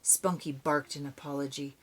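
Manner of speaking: ordinary delivery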